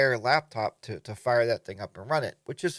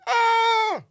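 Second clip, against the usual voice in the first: sudden high startled shriek, much louder; startled scream; 12 dB louder than usual. Retold or imitated. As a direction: yelp like someone just burst through your door. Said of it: "I was like".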